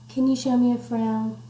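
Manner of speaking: ordinary delivery, nothing marked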